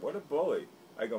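normal talking voice

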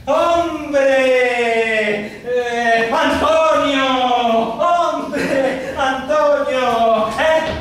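exuberant, drawn-out acted hello; hearty calling out. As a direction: hail an old pal across the street. Acts out hailing a passer-by.

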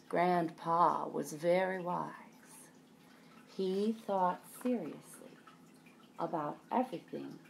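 usual speaking voice

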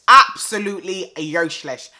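loud, disgusted "useless"; loud exasperation. Real, not acted. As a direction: throw the word out in disbelief. Exasperated verdict.

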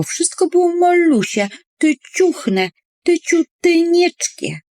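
high, cooing diminutives; tiny-things voice. A narrator's reading. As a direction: make the words sound teeny-tiny. (wysoki, pieszczotliwy głosik)

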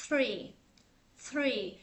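"Three" with tongue tip out, breath pushed through; careful isolated "Three"; correct th in "three". Shown as a demonstration. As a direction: model "Three" slowly apart, th not t. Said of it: "để cái lưỡi ra ngoài xíu".

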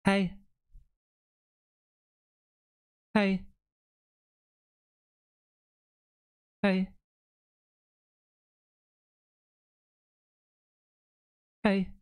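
mock mystery voice from another room; demonstrating a faked creepy call. Shown as a demonstration. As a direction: repeat a short, spaced-out eerie call. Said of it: "I would have done it like this".

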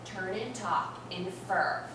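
ordinary talk, nothing marked

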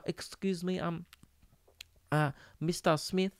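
put-on a soft, timid little kid asking the teacher; hushed schoolboy hesitating over his words. Shy eight-year-old asks the teacher about his painting, hushed and timid. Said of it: "I don't know why I talk like that".

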